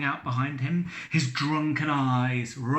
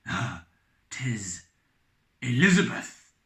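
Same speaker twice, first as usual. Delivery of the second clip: stammered; 3 semitones lower than usual. Acted. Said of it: "stammered Captain Brazil".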